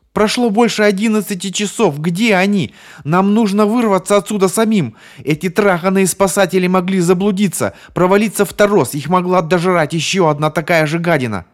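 high, loud frantic rant; frantic yelling. Acted. Bonnie rants about the lost rescuers, frantic and loud. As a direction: rant at them to escape now.

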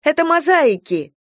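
eager, high and loud; proud of knowing the answer; exclaiming. A narrator's reading. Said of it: "воскликнула я".

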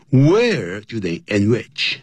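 usual speaking voice

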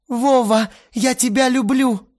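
put-on high, pleading girl's voice, breathy rush; breathed out confession. A narrator's reading. Lena exhales her love to Vova, high and breathy. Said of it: "выдохнула она".

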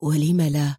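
low, subdued, gentle question; pitying. A narrator's reading. Friend looking at Mona with pity, subdued. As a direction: say it low, with downcast sympathy. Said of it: "تطلع إليها قدري لحظة مشفقا".

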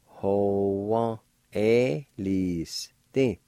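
ho, wa, Eliste stretched out very slowly. Shown as a demonstration. Teacher joining both parts for learners, very slowly. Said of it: "And together".

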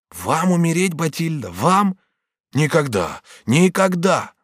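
high, disbelieving outburst; horrified protest. Acted. Bonifas hears Batilda would die, horrified. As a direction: gasp the question, then refuse firmly. Bonifas rejects her dying.

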